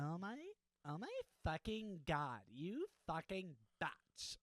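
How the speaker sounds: put-on a bratty valley girl squeal. Shown as a demonstration. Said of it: "That's a little better".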